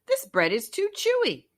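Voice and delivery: high, fussy complaining voice; disgusted goat. A narrator's reading. Goat complaining about raw bread in a high voice. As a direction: whine about the chewy bread.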